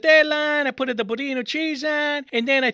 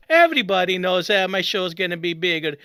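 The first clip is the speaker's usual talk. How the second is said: normal talking voice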